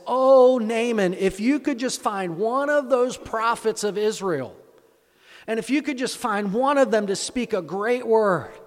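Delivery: high, loud, dramatic wifely plea; pleading wife. Acted. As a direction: implore your husband with a big exclaimed "Oh". Acted wife pleading with Naaman.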